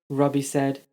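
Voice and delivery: usual speaking voice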